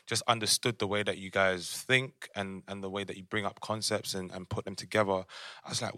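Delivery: usual speaking voice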